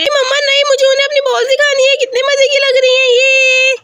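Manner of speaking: put-on high, excited child voice; high-pitched childish eagerness; eager little girl. Voices insistent child Aisha.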